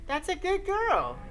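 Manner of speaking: put-on high eerie little-girl praise; lilting high unsettling coo; creepy ghost child. Sachiko voiced again.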